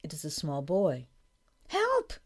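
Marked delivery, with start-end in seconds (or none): 1.7-2.1 very high, drawn-out cry; shouting for help. A narrator's reading. Small boy in the river, shouting for help. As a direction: call out high like a small child. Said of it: "he is shouting".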